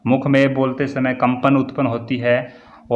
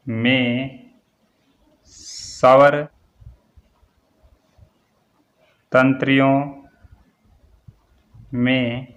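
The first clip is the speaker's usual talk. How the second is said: word by word with long pauses; slow dictation; much slower than usual. Shown as a demonstration. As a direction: dictate it one word at a time for note-takers. Dictating definition for students to write.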